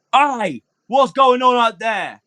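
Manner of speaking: high-pitched challenge from a window; guard calling out. Acted. Game master as guard poking his head out, calling down. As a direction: call out slowly as a suspicious guard. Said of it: "a man seems to poke his head out".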